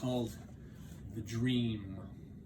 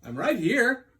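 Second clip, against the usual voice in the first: Frog voice; 8 semitones higher, 9 dB louder than usual. Acted. Frog reassuring waking Toad.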